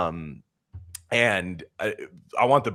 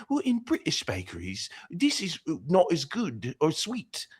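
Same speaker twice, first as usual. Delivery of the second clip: British baking woman; 9 semitones higher than usual. Retold or imitated. Mimicking the baking woman disparaging American food, high British accent. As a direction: put on a dainty high British judge's voice. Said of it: "they love that Great British Baking Woman".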